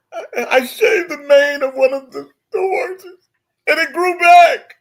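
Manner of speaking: high, loud sobbing plea. Acted. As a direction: blubber it out through tears. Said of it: "starts breaking into his problems".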